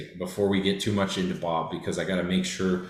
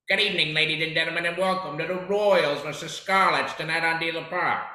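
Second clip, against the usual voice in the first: higher, fast sports host welcome; Bob Sandler; 7 semitones higher than usual. Retold or imitated. Host doing his impression of the team announcer. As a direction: welcome the crowd like a broadcaster. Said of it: "Bob Sandler's not well renowned".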